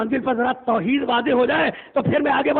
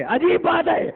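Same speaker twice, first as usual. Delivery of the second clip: emphatic preacher's cry; 8 semitones higher, slower than usual. Shown as a demonstration. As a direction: lift عجیب بات ہے into a ringing call to the crowd. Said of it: "repeated for emphasis".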